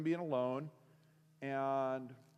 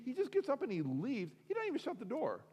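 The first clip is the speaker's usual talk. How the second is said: incredulous; 10 semitones higher than usual. Real, not acted. Incredulous at pastor's exit.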